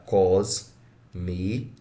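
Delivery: "Cosmi" split into cos and mi; separado em sílabas. Shown as a demonstration. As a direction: pause between the syllables of "Cosmi". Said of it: "Como é que eu separo?". ("Cosmi" sílaba por sílaba, pausado)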